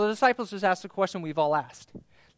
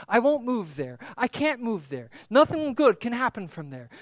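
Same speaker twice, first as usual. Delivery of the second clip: usual speaking voice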